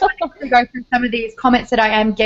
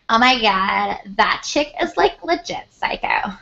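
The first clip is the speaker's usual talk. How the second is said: imitated American accent; slower than usual. Back in America overhearing gossip, mock American accent. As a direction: put on an American accent, pitch climbing through the line. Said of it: "you get back here and it's like".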